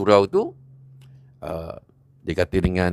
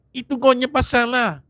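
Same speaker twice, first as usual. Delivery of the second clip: much higher, dismissive angry snap; angry dismissal; 13 semitones higher than usual. Acted. A young man brushes off Haji Hamid, high and angry. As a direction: brush him off in anger.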